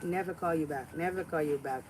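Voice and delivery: ordinary talk, nothing marked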